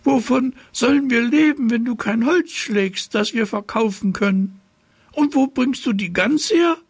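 very high-pitched scolding mother; high, nagging fairy-tale mother; reproachful mother. A narrator's reading. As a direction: press the questions in a high mother's voice. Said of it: "fragte die Mutter".

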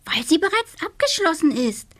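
high little witch voice. Acted. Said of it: "antwortete die kleine Hexe".

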